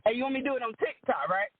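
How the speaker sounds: usual speaking voice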